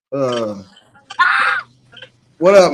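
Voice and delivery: ordinary talk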